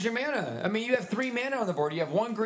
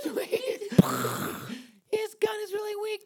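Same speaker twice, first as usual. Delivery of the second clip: higher, frantic chirping; high bird voice; 9 semitones higher than usual. Acted. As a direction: flee like a panicked bird. Bird fleeing.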